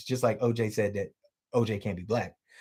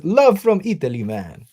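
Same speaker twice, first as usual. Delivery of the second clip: bad Italian impression; 3 semitones higher, 6 dB louder than usual. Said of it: "That's a very bad impression".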